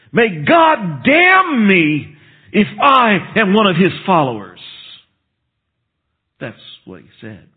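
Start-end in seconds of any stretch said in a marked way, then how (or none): 0.1-4.6 sworn curse. Retold or imitated. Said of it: "he swore curses upon himself".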